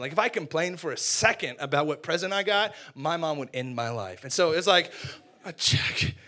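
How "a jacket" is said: voiceless, stunned disbelief; stunned whisper. Acted. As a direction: let the disappointment out on breath alone. Stunned first reaction to gift.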